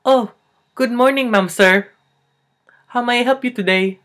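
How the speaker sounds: high, loud, chirpy greeting; over-eager counter clerk voice; Filipino store clerk. Acted. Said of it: "there's a guy behind a counter".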